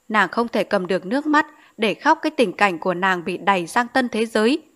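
usual speaking voice

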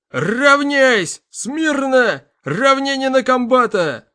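high, loud drawn-out parade roar; shouting drill sergeant. A narrator's reading. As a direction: bellow the commands across the camp, slowly. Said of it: "растянутый рык".

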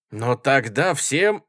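loud, slow, emphatic cry; hot-headed shout. A narrator's reading. Schoolboy challenging the speaker, hot-headed shout. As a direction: shout it out heatedly, stretching the words. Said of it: "запальчиво крикнул хорошенький гимназист".

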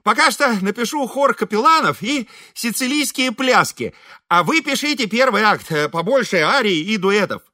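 high-pitched, self-important composer; Ибрагим. A narrator's reading. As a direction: give orders in a high, put-on voice. Ибрагим распределяет работу. (высокий важный голос композитора)

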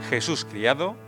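ordinary talk

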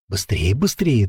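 usual speaking voice

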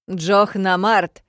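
loud, drawn-out call from behind; shout. A narrator's reading. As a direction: yell the name across the crowd. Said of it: "крикнули сзади". (крик издалека)

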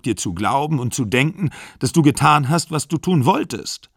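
heated protest. A narrator's reading. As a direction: argue back in exasperated, acted distress. Acted breakup quarrel in novel.

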